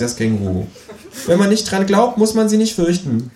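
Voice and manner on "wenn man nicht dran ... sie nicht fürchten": high, cheeky kangaroo voice. Acted. Kangaroo asks narrator to shove over, high cheeky. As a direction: pitch way up for the cheeky marsupial.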